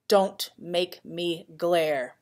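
plain reading voice

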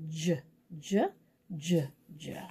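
"J" as a low, short "juh"; letter sound. Shown as a demonstration. Teacher searching the page for J's, each "J" sounded out as juh. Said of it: "sound out this letter J sound".

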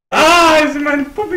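mock whine. Mock lament at game.

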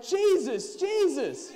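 high, loud cheering cry; full-voiced, high calls; excited crowd. A narrator's reading. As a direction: shout the name like an excited crowd. Crowd cheers on seeing Jesus.

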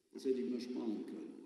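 plain reading voice

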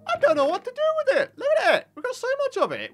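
exasperated excitement. Real, not acted. Overwhelmed by piles of sugarcane.

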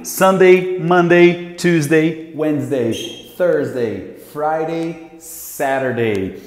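brisk, loud weekday drill; drill recital. Shown as a demonstration. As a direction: rattle off the days for students. (recitação animada dos dias)

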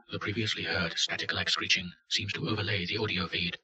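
plain reading voice

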